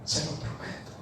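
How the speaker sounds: hushed whisper into his ear; whispered promise. Retold or imitated. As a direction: whisper it close, a secret vow. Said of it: "se pega al oído y me dice". (susurro al oído, muy bajo)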